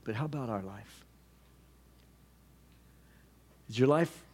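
hushed close preaching. Acted. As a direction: ask it gently, like holding up a mirror. Quiet reflective application.

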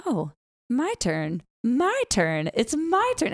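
put-on whiny little kid building up; lightly voiced demanding toddler. Toddler demanding her turn.

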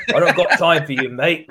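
laughing imitation of a New Zealand accent; mock New Zealand accent. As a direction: lightly mimic a Kiwi brushing you off. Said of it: "Something with an accent".

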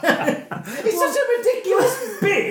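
high, slow words through laughter; real laughing. Real, not acted. Film fan reacting to an absurd scene, laughing. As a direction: laugh helplessly as you say it.

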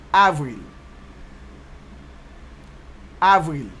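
avril said as ahv-REEL, firm and loud; Creole pronunciation. Shown as a demonstration. Teacher giving learners April in Creole, avril as ahv-reel. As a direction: model avril for repeating, pause between takes.

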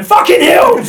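high, loud mock yell of "Fucking hills"; mock shout. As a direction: bellow it at the hill in jest. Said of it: "the sound of you going".

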